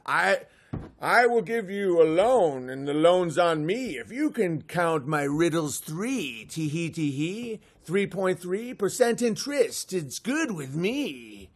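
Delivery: slow put-on rhyming voice with tee hee; a trickster offering a loan in verse; leprechaun rhyme. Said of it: "It's like".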